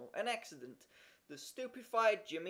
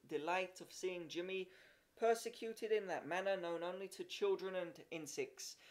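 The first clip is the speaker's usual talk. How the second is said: ordinary delivery, nothing marked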